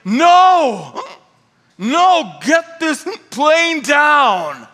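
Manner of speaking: loud, high, drawn-out yell; screaming and shouting. Retold or imitated. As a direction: imitate a drunk passenger's outraged shouting. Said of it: "who started screaming and shouting".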